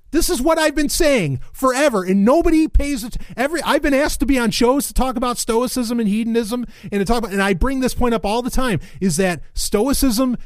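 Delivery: high-pitched, worked-up complaint; fed up and insistent, voice climbing high; exasperated rant. Real, not acted.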